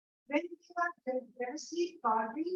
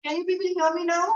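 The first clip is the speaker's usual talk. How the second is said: louder, fast call to the class; speaking up; 4 semitones higher, 8 dB louder, faster than usual. Real, not acted. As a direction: raise the voice so all can hear. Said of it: "Could you speak up a little?".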